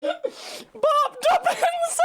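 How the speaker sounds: drawn-out name dissolving into laughter, pitch way up; laughing out the name. Real, not acted. Streamer cracking up at the nickname, laughing it out. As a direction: cackle through it, stretching each syllable.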